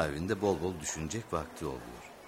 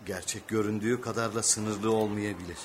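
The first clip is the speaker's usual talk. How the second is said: ordinary talk, nothing marked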